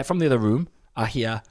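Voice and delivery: normal talking voice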